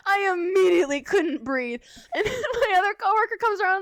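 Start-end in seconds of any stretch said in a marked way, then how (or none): 0.1-1.8 breathless with laughter; laughing. Real, not acted. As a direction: laugh through the words. Laughing while telling it.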